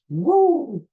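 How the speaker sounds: loud, high whoop; Ric Flair woo. Retold or imitated. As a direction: whoop it like the wrestler. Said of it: "That was his thing".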